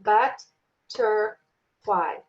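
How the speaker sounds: "but-ter-fly" in three slow, separate beats; clapped-out syllables. Shown as a demonstration. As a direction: break "but-ter-fly" apart with a gap after each syllable. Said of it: "So if I clap butterfly".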